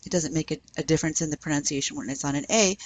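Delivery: usual speaking voice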